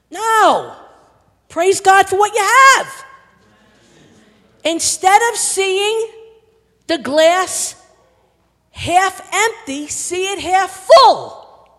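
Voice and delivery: loud, high exhortation, stretched out; full-force preaching. Real, not acted. Preacher urging congregation to praise God, full force. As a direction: build to a shouted last word.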